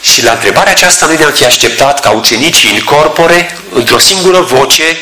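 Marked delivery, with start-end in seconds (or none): none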